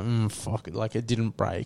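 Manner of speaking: low, soft muttered thought; imitated inner grumble. Maz privately annoyed it didn't break, imitated quiet grumble. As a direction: mutter it under your breath as his private thought. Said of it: "I see it going through Maz's head".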